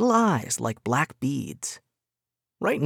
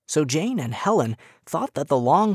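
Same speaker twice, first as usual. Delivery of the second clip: ordinary delivery, nothing marked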